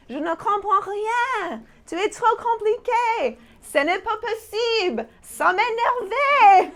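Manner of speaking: high, loud, exasperated whine; mock exasperation. Ranting about a complicated man, mock exasperated and loud. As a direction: climb into a fed-up squeal.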